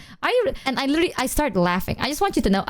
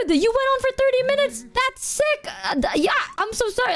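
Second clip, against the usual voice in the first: high-pitched retold admiration; mock-earnest praise pitched way up; amazed, apologetic; 13 semitones higher than usual. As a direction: gush in a high voice, impressed and sorry.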